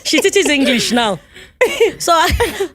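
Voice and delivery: high, loud, laughing through the words; can't stop giggling as she speaks; amused, laughing chatter; laughing while talking. Real, not acted. Laughing while recounting a story.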